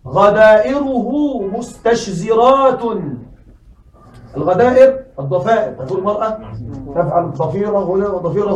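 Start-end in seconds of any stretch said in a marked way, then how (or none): 0.1-2.8 غدائره مستشزرات recited very slowly; slow verse quote. Shown as a demonstration. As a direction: savour the line of poetry slowly. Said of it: "شاعر يقول".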